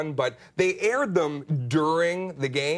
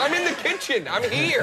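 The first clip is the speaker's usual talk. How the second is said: annoyed; 11 semitones higher, 3 dB louder than usual. Retold or imitated. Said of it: "You're like".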